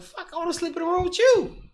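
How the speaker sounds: a high mock-eager plea. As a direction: squeak it out like a joke. His own retold line, put-on high voice.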